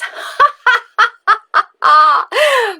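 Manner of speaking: high loud witch laugh; witch cackle. Retold or imitated. The witch cackling before praising the brave child.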